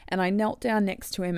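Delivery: ordinary talk, nothing marked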